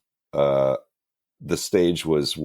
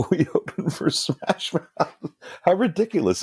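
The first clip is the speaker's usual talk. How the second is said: laughing; 13 semitones higher than usual. Real, not acted. Laughing at the absurd gig.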